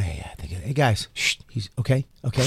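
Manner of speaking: soft, hushed mock shush. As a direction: shush the crowd quietly. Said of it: "We were back there going".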